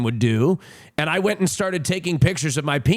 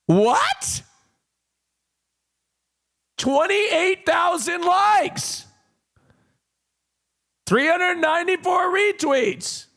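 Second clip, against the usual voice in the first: high, drawn-out outraged squawk; shocked disbelief; 12 semitones higher, slower than usual. Real, not acted. Streamer reacting to the post's like count, high-pitched disbelief. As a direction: pitch way up and stretch the words in disbelief. Reacting to the viral quote retweet.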